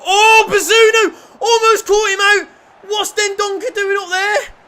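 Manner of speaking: high, full-volume yelling at the players; alarmed shout. Real, not acted. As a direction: yell in real disbelief at the near miss. Reacting to keeper's near mistake.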